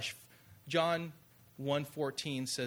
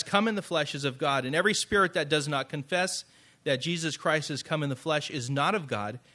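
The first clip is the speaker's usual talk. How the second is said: firm, louder scripture reading from the pulpit; 5 dB louder than usual. Acted. Pastor reading First John to his congregation, firm and louder. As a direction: proclaim the verse with weight.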